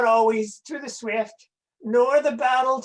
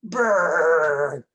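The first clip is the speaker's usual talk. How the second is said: long, rolled brr against the cold; drawn-out cold shudder; shivering brr; 5 semitones lower than usual. Acted. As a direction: play a comic shiver. Puppet imitates shivering at cold.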